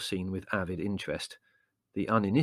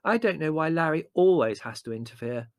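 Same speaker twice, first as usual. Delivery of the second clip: high, loud complaint; aggrieved, bitter whine; 7 semitones higher, 5 dB louder than usual. A narrator's reading. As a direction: complain with resentment. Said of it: "Margot remarked bitterly".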